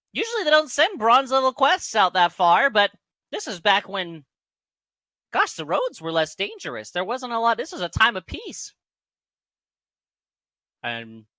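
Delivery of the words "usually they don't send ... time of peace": high, loud halfling storyteller; Garth. Acted. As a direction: speak up in a raised, piping voice. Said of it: "Garth just speaks up".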